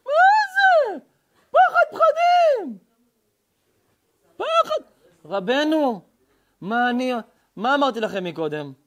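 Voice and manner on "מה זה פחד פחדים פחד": high falsetto mock fright; mock terror. Preacher jokes about being frightened, mock terror. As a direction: draw it out in hammed-up, high-pitched dread.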